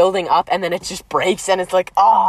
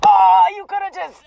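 high, loud mock groan; hammed-up annoyed outburst; acted exasperation; 8 semitones higher, 4 dB louder than usual. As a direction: wail it at the screen in exaggerated frustration. Acts out annoyed viewer.

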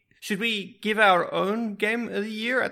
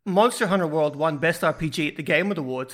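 ordinary talk

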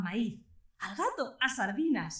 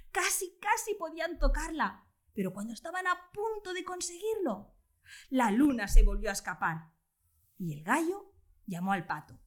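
ordinary delivery

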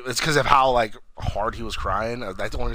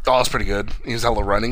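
ordinary delivery

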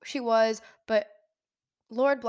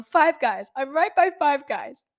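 mildly mock-hysterical, higher and louder; 4 semitones higher, 5 dB louder than usual. Daughter telling dad where she crashed, hysterical. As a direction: blurt it out as a retold panicked answer. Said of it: "I'm hysterical still".

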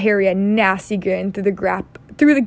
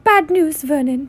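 higher-pitched mildly worried aunt; a touch of anxious annoyance, pitched up; angry, worried aunt; 6 semitones higher than usual. A narrator's reading. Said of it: "looking both angry and worried".

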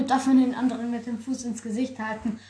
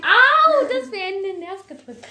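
high, loud yelp of pain; pained cry; 7 semitones higher, 7 dB louder than usual. Real, not acted. Punched hard on the arm, she yelps in pain. Pressed on a nerve.

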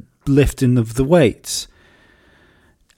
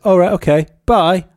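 high, louder, breezy "All right, okay, bye"; breezy goodbye; 8 semitones higher, 5 dB louder than usual. Retold or imitated. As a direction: brush him off cheerfully. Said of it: "turned and said".

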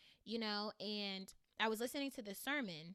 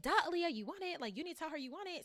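mom voice; 7 semitones higher, much faster than usual. Retold or imitated. Mom pushing her daughter to tell the recruiter, higher exasperated mock. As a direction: play mom rolling her eyes, then rushing. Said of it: "My mom".

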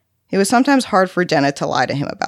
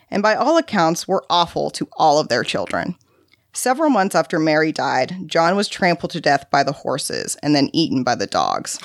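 ordinary delivery

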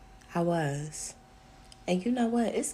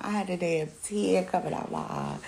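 ordinary talk

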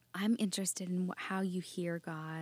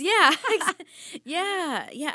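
loud, high laughing yeahs; real laugh breaking through the yes; laughing agreement; 10 semitones higher, 14 dB louder, much slower than usual. Real, not acted. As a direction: burst out laughing while agreeing. Laughs at dirty-place joke.

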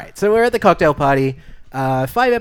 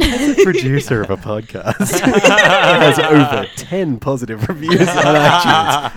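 laughing through a mock boast; chuckling while talking, slowed; 8 dB louder than usual. Real, not acted. Laughing bragging about reviews.

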